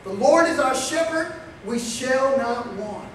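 usual speaking voice

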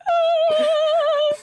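very high, loud imitated wail; mock sob. Imitating the little brother crying by the sandcastle, high wail. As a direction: whimper like a crying toddler.

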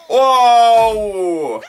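long shouted "wow", falling away; loud wow. Real, not acted. Gamer reacting to what appears on screen, loud wow. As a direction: bellow a stretched-out wow.

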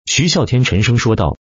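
ordinary delivery

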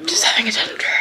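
whispered aside, soft and voiceless. Real, not acted. Mum whispering to viewers about her toddler's tantrum.

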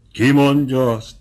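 usual speaking voice

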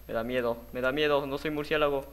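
ordinary talk

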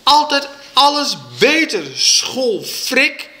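high, angry yelled insult; schreeuwende moeder. A narrator's reading. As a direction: yell it in full quarrel. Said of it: "zo ging het geschreeuw maar door". (boos uitschreeuwen)

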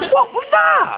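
very high mocking squeak; squeaky high. Mocking the horse impression.

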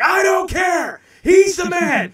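high, full-voiced shout; booming declaration. Retelling a Simpsons scene to the hosts, booming declaration. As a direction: bellow it with total stubborn conviction. Acting out the cartoon half brother.

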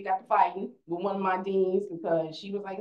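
ordinary delivery

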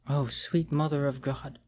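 quiet, awestruck breath of dread; hushed whisper. A narrator's reading. Girlfriend whispering over the phone in terror. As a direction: barely voice it, terrified. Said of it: "she whispered over the phone".

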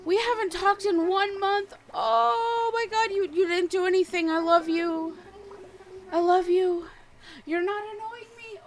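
high-pitched gushing apology to a game villager; high excited pleading. Real, not acted. Streamer apologizing to her neglected villager, high and pleading.

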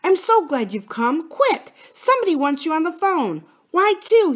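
high, eager and glad; joyful. A narrator's reading. Boy passes on a phone message to Bill, joyful. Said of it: "said Philip joyfully".

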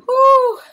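loud, high whew of relief; real whoop of relief after a scare; relieved whew. Real, not acted. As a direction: let out a big high "whew".

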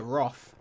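"Roth" with an open British aw; British pronunciation. Shown as a demonstration. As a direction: say "Roth" to rhyme with cloth, the UK way. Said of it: "we call it Roth".

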